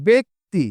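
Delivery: ব্যক্তি said "bek-ti", the ব্য as "be"; pronunciation demo. Shown as a demonstration. Teacher to students, modelling ব্যক্তি with an e sound. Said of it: "তার মানে কি হবে একটু উচ্চারণ".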